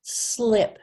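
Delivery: emphatic slip; loud model word. Shown as a demonstration.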